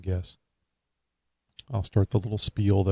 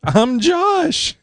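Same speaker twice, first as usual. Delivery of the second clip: mock Josh voice; 6 dB louder than usual. Co-host jokingly claims Josh's name.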